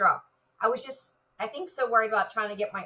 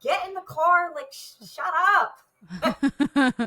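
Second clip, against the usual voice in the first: much higher, louder mock yell; retold screaming; 6 semitones higher, 6 dB louder, much slower than usual. Officer yelling at driver who went to wrong entrance, loud high yell. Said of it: "remember screaming".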